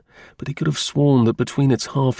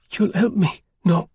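higher woman's voice, begging for help; entreating; 9 semitones higher than usual. A narrator's reading. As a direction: coax him into helping her. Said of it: "she entreated".

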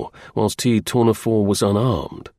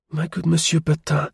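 higher-pitched voice of a countess; woman's voice; 5 semitones higher than usual. A narrator's reading. Countess addressing her helper, in a higher woman's voice. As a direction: lift the voice into a lady's register. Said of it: "came at one time from a woman's voice".

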